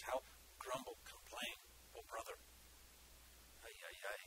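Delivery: hushed, quick grumbling to yourself; murmured grumbling. Acted. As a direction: mutter complaints under your breath. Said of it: "murmur, and grief".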